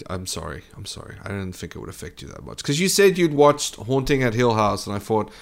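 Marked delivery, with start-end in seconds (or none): 0.1-2.6 soft, low-key sorry; quiet apology. Real, not acted. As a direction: apologise softly, voice dropped well down. Sheepish apology to upset friend.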